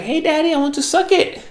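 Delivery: high-pitched mock seductive woman; French prostitute's come-on. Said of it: "she was French".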